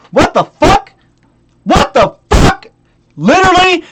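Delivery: loud, high-pitched disbelief; exasperated outburst. Real, not acted. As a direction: explode in baffled frustration. Outburst at buyer's excuse.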